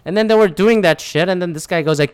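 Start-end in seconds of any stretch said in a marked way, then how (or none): none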